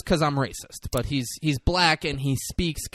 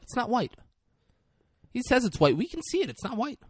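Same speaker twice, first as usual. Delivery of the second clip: higher, insistent disbelief; mock indignation; 11 semitones higher, faster than usual.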